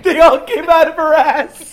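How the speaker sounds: loud laughing joke. Real, not acted. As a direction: burst out laughing as you say it. Joking exaggeration, loud.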